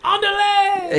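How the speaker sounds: very high-pitched mock cheer; hammed-up, high "go go" holler; mock triumphant cry. Co-host cheering on the imagined border climber, high mock cry.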